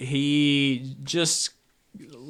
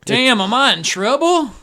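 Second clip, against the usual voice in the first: put-on loud, high drunken cry; Crazy Eddie; 11 semitones higher, 9 dB louder than usual. Drunk worried he is in trouble, loud befuddled holler. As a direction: blurt it out like an alarmed drunk. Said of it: "Crazy Eddie is the town drunk".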